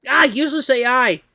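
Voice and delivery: high, loud exasperated cry; frustrated shout. Acted. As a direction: vent at the AI.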